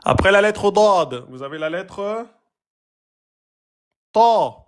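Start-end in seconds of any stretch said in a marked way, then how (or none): 4.1-4.6 ta said high, loud and full; emphatic letter. Shown as a demonstration. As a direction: punch a heavy ta. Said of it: "Ta, pas te".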